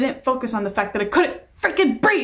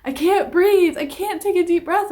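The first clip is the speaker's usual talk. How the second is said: put-on panicky child; 8 semitones higher, 4 dB louder than usual. Panicking child in parents' car.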